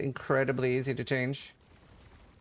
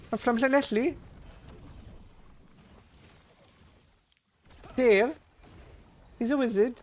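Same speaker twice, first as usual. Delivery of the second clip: high, sing-song Welsh lilt rising at the end; mock Welsh accent; 9 semitones higher, 4 dB louder than usual. Shown as a demonstration. Streamer testing a Welsh accent for chat, high sing-song Welsh lilt. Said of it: "It's basically a reverse Welsh".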